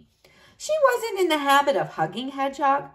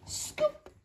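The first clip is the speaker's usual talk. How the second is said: whispered; 11 dB quieter than usual. A narrator's reading. Playful whispered sound word.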